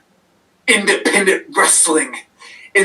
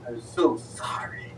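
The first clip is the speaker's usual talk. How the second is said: much softer, breathy whisper, lower; menacing whisper; 7 semitones lower, 12 dB quieter than usual. Acted. Wrestler taunting his opponent, menacing whisper. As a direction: hush it like a creepy threat.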